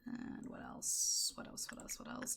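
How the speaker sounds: barely audible wondering; whispered musing. Real, not acted. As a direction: mull it over in a whisper. Thinking aloud, picking next.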